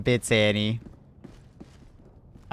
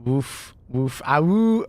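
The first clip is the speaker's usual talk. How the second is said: dog woofs; much slower than usual. Pretend. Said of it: "Can you woof now for one hundred bits".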